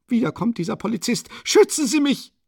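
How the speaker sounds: high, loud appeal; calling out for protection. A narrator's reading. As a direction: call out urgently to the official. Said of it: "rief sie dem Beamten zu". (laut und hoch um Hilfe rufend)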